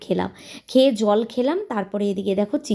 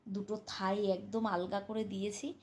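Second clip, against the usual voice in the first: hushed talking; 9 dB quieter than usual. Real, not acted. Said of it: "আস্তে আস্তে কথা বলছি".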